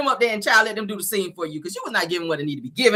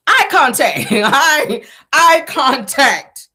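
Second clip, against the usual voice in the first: "eye contact" drawn out slowly, louder, laughing; demonstrating eye contact; 4 semitones higher, 5 dB louder, much slower than usual.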